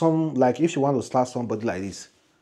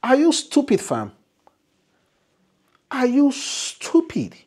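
high, loud, scornful divine voice; a mocking heavenly voice scolding the fool; voice from above; 11 semitones higher, 4 dB louder than usual. The angel's voice mocking the speaker, high and scornful. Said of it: "the voice said to me".

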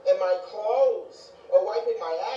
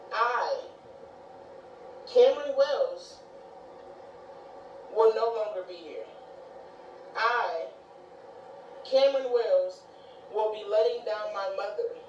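emphatic, deliberate pledge; solemn vow. Real, not acted. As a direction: swear it firmly, pausing after "I". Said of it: "this is my vow".

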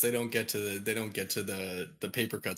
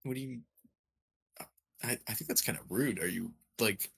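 normal talking voice

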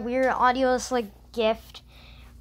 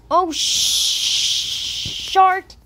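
startled yelp; 6 semitones higher, 5 dB louder, much slower than usual. Real, not acted. Startled in horror game.

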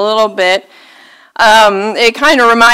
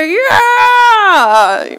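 high, gleeful mock cheer; 10 semitones higher than usual. Churchgoer mocking a rival's crash, gleeful cheer.

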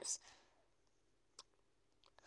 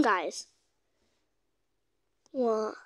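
normal talking voice

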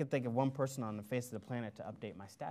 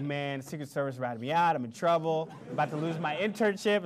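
higher, louder nervous rush; panicked worry; 4 semitones higher, 7 dB louder than usual. Retold or imitated. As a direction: blurt it out like you're in big trouble. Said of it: "I start sweating bullets".

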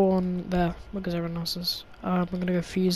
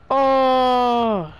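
loud dismayed oh; 5 semitones higher, 7 dB louder than usual. Real, not acted. Loud exclamation.

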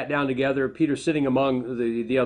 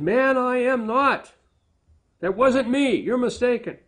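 Peter's denial; 11 semitones higher than usual. Acted. Peter denies knowing Jesus.

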